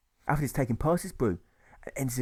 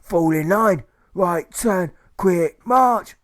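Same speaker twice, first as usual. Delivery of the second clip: loud, higher drill-sergeant commands; barked drill orders; 7 semitones higher, 9 dB louder, much slower than usual. A narrator's reading. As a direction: bark the marching orders. Provost marshal barks orders.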